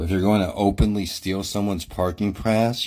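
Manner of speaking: ordinary talk, nothing marked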